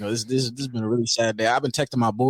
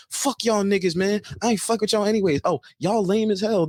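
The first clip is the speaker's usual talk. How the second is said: higher-pitched mock trash talk; mocking a girl; 8 semitones higher than usual. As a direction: imitate her dissing the whole group. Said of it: "she'll be like".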